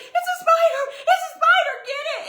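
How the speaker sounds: high, frantic shouts for help; re-enacted panic. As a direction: shriek the alarm about a spider. Acts out panic at spider.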